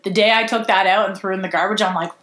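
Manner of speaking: normal talking voice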